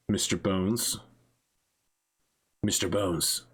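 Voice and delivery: low put-on character voice; Ghost Score. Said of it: "he's lowering his tone and faking an accent".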